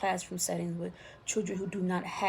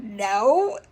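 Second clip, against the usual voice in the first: loud laughing no; 2 semitones higher, 13 dB louder than usual. Retold or imitated. Brother laughing, flat refusal.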